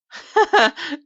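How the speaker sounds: loud, high scoffing laugh; a snooty hotel owner laughing at ghost talk; mocking laugh. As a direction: laugh off the question haughtily. Socialite mocks ghost question.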